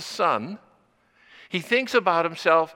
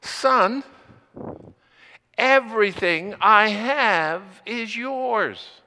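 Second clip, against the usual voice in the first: pleading father; 4 semitones higher, 5 dB louder, slower than usual. Acted. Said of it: "He says".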